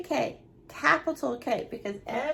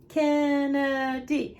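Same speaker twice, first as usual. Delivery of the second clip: slow, syllable by syllable; counting syllables; 4 dB louder, much slower than usual. Shown as a demonstration. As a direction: break Kennedy into three slow beats. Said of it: "how many syllables?".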